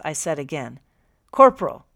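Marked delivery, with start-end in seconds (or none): 1.3-1.9 loud high bellow of one word. A narrator's reading. Captain summoning the corporal again, bellowing. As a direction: call him in, loud and high. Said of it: "he bellowed again".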